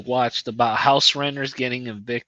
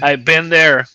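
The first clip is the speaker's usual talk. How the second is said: louder, punchy I've been there; loud emphatic agreement; 8 dB louder than usual. Real, not acted. Friend sympathizing about a lazy coworker, loud emphatic agreement.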